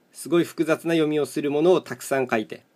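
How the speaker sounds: ordinary talk, nothing marked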